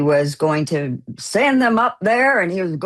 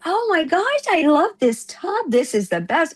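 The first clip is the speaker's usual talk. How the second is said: delighted exclamation; 9 semitones higher than usual. Retold or imitated. Friend raving about the hotel tub, delighted. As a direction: gush over it in a higher voice. Retold quote of delighted man.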